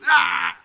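loud, high imitated cry of rage; acted-out burst of fury; furious growl. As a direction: let out a child's tantrum "aah". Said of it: "He is furious".

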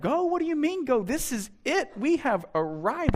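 mock incredulous. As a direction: act baffled at the call. Believer protests God's call.

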